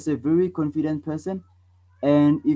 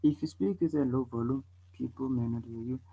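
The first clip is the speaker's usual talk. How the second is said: soft voice sinking to very quiet; quiet, under-projected demonstration; low volume; 8 dB quieter than usual. Shown as a demonstration. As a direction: drop the volume until barely heard. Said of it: "So can you tell the difference?".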